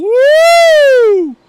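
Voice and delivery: long, loud, high woo; excited whoop. Real, not acted. Commentator cheering a driver who's ripping, long loud whoop. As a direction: hold a big high whoop.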